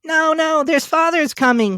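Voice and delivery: little ones squealing a warning; children crying out. A narrator's reading. The young Cratchits warn that father is coming, high and loud. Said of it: "cried the two young Cratchits".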